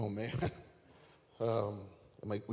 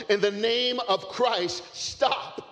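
slow, much higher and louder cry; yelled command; 13 semitones higher, 9 dB louder, slower than usual. Acted. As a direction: call out over the crowd, slowly. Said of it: "began to yell to the crowd".

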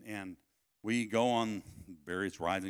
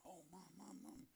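put-on very soft, higher child's complaint; hushed, raised-pitch plea to Mom; imitated whimper; 7 semitones higher, 23 dB quieter than usual. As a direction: faintly mimic a hurt little boy. Imitates his hurt, crying son.

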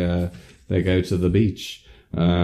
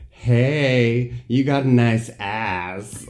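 higher, drawn-out lechy catcall; catcalling a passer-by, slow and pitched up; lechy man; 5 semitones higher, much slower than usual. Retold or imitated. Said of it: "like, a lechy man".

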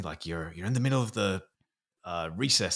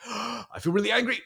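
startled realization; 13 semitones higher than usual. Acted. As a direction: catch yourself mid-reaction. Acting sudden emotional realization.

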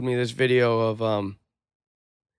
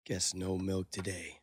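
slow, much softer mock wildlife narrator; documentary narrator voice; 5 semitones lower, 12 dB quieter than usual. Joking to a friend about the wolf, soft slow narrator impression. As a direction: hush it like a nature show, deadpan. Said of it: "that fucking voice that".

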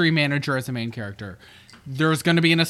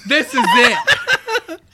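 laughing mock miner; 8 semitones higher, 7 dB louder, slower than usual. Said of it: "When the bloody miner breaks".

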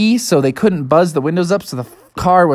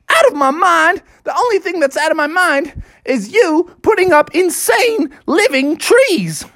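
high-pitched, indignant arguing; outraged comeback; 10 semitones higher than usual. Acted. Dad argues with the officer, outraged. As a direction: throw the accusation straight back.

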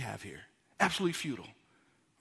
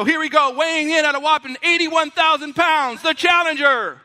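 loud, higher boxing announcer hype; ring announcer; 6 semitones higher, 6 dB louder than usual. Acted. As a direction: boom the challenger's intro to the crowd. Mock boxing ring introduction.

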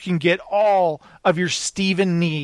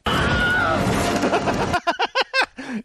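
long, far higher mock scream like the stock film scream; imitated Wilhelm scream. Host mimics the movie's famous stock scream, hammed up. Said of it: "It's got the Wilhelm scream".

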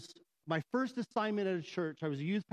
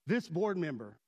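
ordinary talk, nothing marked

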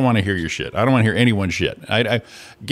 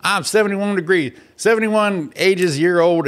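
put-on higher, slowed old-lady complaint; mock old lady grumbling, higher; imitating the old lady; 9 semitones higher, slower than usual. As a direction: whine it out like a tired old woman. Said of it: "She goes".

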